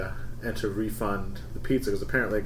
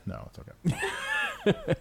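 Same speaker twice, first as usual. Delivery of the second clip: laughing; 4 dB quieter, much slower than usual. Real, not acted. Laughing protest to friend.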